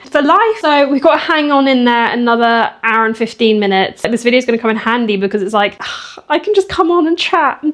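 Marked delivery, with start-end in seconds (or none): none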